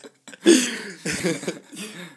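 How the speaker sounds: big burst of laughter; loud laugh. Real, not acted. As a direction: cracks up loudly. Big laugh after joke.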